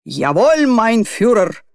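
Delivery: hammed-up German accent shout; mock a soldier saluting; mock German salute. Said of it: "закричал Сутягин".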